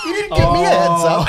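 high, loud complaint; hammed-up yelled protest; mock indignation. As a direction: squawk it in playful outrage. Acting out indignant complaint amid laughter.

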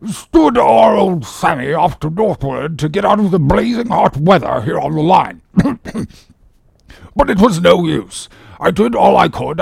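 self-important doctor giving his case history; ship surgeon. Acted. Surgeon boasting of his care for the patient, pompous. Surgeon tells of treating the captain.

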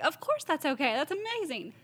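high-pitched, delighted yes; eager, high-pitched mock gush; retold excited agreement. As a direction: gush it in a high voice, acting out the reply. Retold eager reply to a request.

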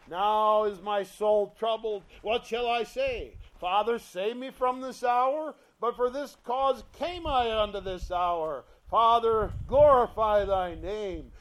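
loud, fervent scripture read; fervent pulpit reading; preaching prayer. Acted. Reads Jesus' prayer.

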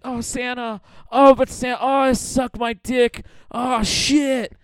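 high-pitched imitation of ecstasy; mock moaning. Miss Claus in retold sex sketch, mock moaning. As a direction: moan it out, hammed up.

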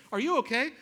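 a light mimic of the wife checking on him; imitating wife. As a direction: call back up the trail, a bit worried. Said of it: "And she's like".

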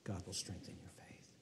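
hushed whispered assurance; soft whispered promise. Acted. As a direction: whisper it close to them.

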